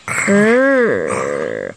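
low growl sound; dog growl. Acted. Child growling as Floppy shakes Teddy, low.

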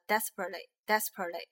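desperately with the T held back before "ly"; almost say the T in desperately, without releasing it; unreleased T. Shown as a demonstration. Teacher showing T before LY, swallowed T in desperately. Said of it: "那个T不是很清晰的发出来的".

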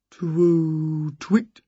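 long twoo, short twit; owl hoot. A narrator's reading. Strange owl calls.

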